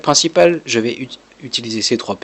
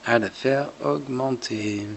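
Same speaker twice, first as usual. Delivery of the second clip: ordinary talk, nothing marked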